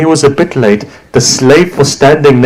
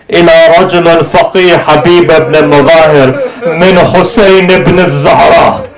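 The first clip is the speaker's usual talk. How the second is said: high, soaring majlis lament chant; keen the letter out like a mourning reciter; lament recitation; 8 semitones higher than usual. Acted.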